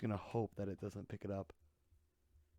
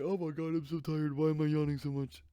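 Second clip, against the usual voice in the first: higher, louder mock of a tired teen; mocking whiny kid; 4 semitones higher, 4 dB louder than usual. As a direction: whine it like a spoiled kid. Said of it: "kids nowadays, everybody".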